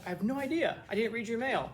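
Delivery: lightly acted hands-off answer; mock protest. Fends off students' questions.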